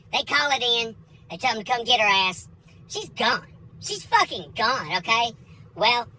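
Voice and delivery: ordinary talk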